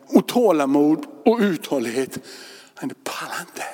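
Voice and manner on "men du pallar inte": whisper. Acted. Repeated as a whisper.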